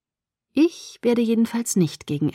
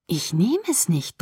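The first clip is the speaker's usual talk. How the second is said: threatening. A narrator's reading. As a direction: refuse slowly, as a warning. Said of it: "drohte ich".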